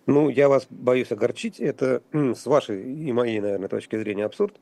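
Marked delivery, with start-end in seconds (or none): none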